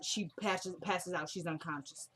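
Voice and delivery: normal talking voice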